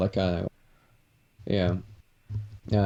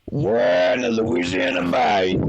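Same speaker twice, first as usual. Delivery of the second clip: slow, louder Southern American drawl; exaggerated American accent; 4 dB louder, slower than usual. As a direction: ham up a Louisiana Southern accent. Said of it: "my American accents are very exaggerated".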